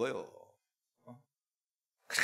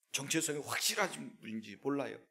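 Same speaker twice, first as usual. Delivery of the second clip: ordinary delivery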